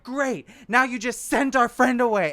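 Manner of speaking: high, loud, bitter complaint; exasperated drunk wife. Retold or imitated. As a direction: scold him like a drunk wife. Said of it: "Julia was like".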